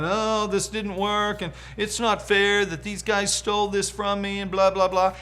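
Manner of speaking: griping like a sulky complainer; mock griping. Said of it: "complaining and griping".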